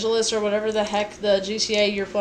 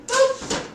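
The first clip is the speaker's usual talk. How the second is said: boop; 3 dB louder than usual. Real, not acted. Playful sound while cooking.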